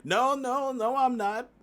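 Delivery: high, loud mock protest; protesting denial. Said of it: "he'd be like".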